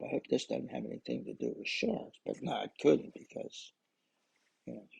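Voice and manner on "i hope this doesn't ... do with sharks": hushed, breathy dread under the breath; whispered thought. Retold or imitated. Diver whispering his dread of sharks to himself. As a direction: whisper it like a secret fear.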